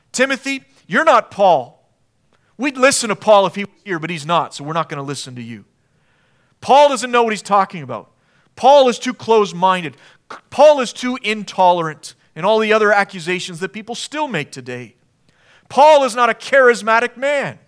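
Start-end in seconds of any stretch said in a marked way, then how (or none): 0.1-12.1 louder, higher, scornful jeering at Timothy; mocking critics. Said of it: "They're gonna fight Timothy". 15.7-17.6 loud, high dismissive jeer; scornful critic. Retold or imitated. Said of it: "They're gonna fight Timothy".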